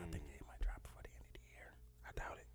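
hushed whisper. Real, not acted. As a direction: whisper it under your breath. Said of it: "Q, why are we whispering?".

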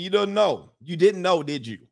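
normal talking voice

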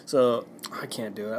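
ordinary talk, nothing marked

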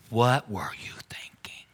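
hushed, breathy whisper of a worried parent; whispered parent question. Retold or imitated. Pastor voicing an upset parent to kids, whispered.

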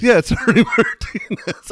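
high-pitched, loud laughing agreement. Real, not acted. Radio host joking along with his co-host, laughing. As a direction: crack up while running with the joke.

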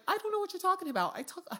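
very high-pitched, hammed-up impression of a defensive woman; mock Becky voice. Woman denying she switched accents when mad, high mock voice. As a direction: imitate her squeaky-high protesting voice. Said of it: "Where'd your accent go".

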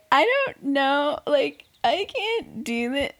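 high-pitched imitation of a tearful contestant; mock crying. Imitating JoJo in her little interview, mock crying. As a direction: whine it out as a fake weepy confession. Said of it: "she's crying. She's like".

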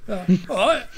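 mock bearded producer. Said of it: "he's like".

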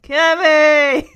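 yelling the name Kimmy at the screen; shout. Real, not acted. Vlogger shouting at the character on screen, loud.

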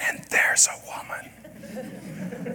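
slow, hushed whisper with a chuckle; whispered punchline. Acted. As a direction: lean in and whisper it, amused.

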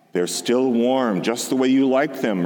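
ordinary delivery, nothing marked

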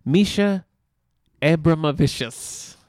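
Abramovicius spelled out slowly, syllable by syllable; slow, loud, deliberate Misha Abramovicius; careful name reading. Shown as a demonstration. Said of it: "read that name for me out loud".

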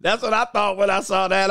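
normal talking voice

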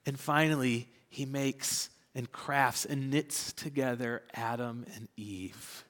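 ordinary delivery

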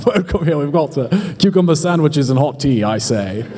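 mock posh British host, lightly voiced; British accent. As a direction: play a stuffy Englishman offering tea. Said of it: "God's like a limey".

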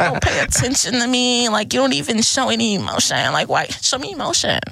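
high-pitched imitated complaint; mock pleading. Woman mimicking partners begging for attention, high mock whine. Mocking women demanding emotion from men.

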